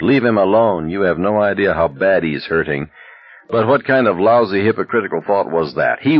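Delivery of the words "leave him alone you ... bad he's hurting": usual speaking voice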